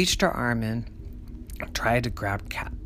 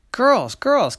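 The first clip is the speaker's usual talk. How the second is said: calling out; 12 semitones higher, 9 dB louder than usual. Acted. Auntie calling the girls.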